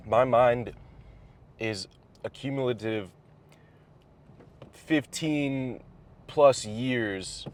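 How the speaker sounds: normal talking voice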